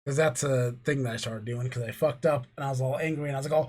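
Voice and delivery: ordinary talk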